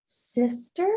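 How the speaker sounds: small child's wondering voice, lightly voiced; toddler's question. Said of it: "she just goes".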